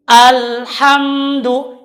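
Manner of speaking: Alhamdu with slight bounce; Alhamdu, small push on m. Shown as a demonstration. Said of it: "ada sedikit unjatan". (Alhamdu dengan sedikit unjatan)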